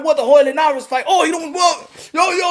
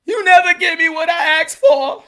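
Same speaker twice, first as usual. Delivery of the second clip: mock complaint; 5 semitones higher, 3 dB louder than usual. Fan complaining to boxing.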